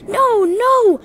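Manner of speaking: high, loud wail of alarm. A narrator's reading. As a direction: cry out in high-pitched dismay. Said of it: "wailed the empty trucks".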